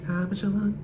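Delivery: high breathy sexy whisper, half sung; little whisper voice. Retold or imitated. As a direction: coo it like a sultry pop singer. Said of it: "have a little whisper voice".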